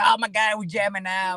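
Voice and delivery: lightly hammed-up thrill at a jam; excited party quote. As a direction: imitate a young drinker getting into the music. Voicing young drinkers enjoying music.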